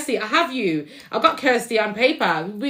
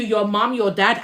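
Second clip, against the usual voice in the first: ordinary talk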